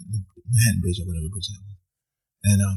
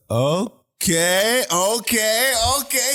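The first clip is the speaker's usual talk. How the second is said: rising higher with each okay; a stunned okay climbing in disbelief; 12 semitones higher, 5 dB louder, much slower than usual. Retold or imitated. Stunned reaction to bandmate leaving.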